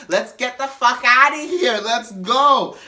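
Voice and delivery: high, loud mock holler; impatient drunk, stretched and insistent; drunken demand. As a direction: shout it to hustle everyone out. Drunk Donette demands to leave.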